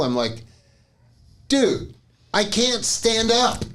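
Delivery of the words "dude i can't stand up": high-pitched, drawn-out plea; exasperated protest. Retold or imitated. Dizzy patient answering the orderly's accusation, exasperated.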